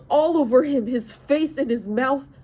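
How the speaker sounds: loud, snarling fury. Acted. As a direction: bite out every word in rage. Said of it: "I snarled".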